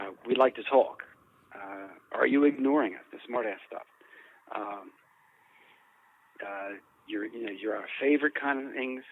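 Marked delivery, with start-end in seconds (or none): none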